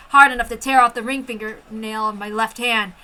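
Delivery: ordinary delivery, nothing marked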